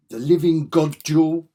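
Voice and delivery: loud, slow, high proclamation; hammy cover announcer. Acted. Fan reading a comic cover title aloud, grandly declaimed. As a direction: trumpet it like an epic title.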